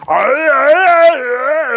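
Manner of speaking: high, loud growling vampire arr. Acted. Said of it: "The vampire's going".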